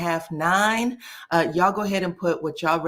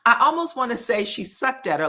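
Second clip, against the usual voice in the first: ordinary talk, nothing marked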